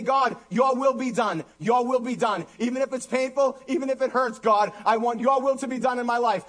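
high, pleading pulpit prayer; fervent prayer. Real, not acted. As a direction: lead them in surrendering to God. Preacher leads a prayer.

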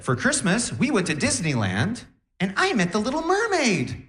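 put-on high, sing-song little rich girl; rich schoolgirl. Rich girl tells the class about Christmas, high girlish show-off voice. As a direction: imitate a proud rich girl, voice climbing. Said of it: "was a rich girl ... and she said".

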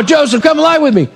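high-pitched, pressing demand; insistent seduction. Acted. Potiphar's wife pressing Joseph after his refusal, insistent. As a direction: push him, pitch well up.

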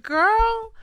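hammed-up sassy warning to a friend; exaggerated disbelief, tipping into a laugh; mock scolding. Retells her mock scolding of colleague.

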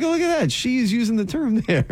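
high-pitched retold amazement; mock-amazed, high-voiced discovery; retold surprise. Retelling own surprised reaction to speech.

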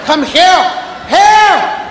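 loud, high come here; shouting at child. Retold or imitated. Parent calling an ignoring child, loud yell. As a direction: finally yell for the kid. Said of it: "barrage of hears".

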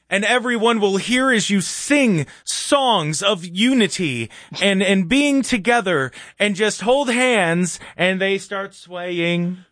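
slow, swooning mock-idealist; hammed-up kumbaya sweetness; mock-dreamy. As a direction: stretch it out in sarcastic rapture. Mocking unity singalong.

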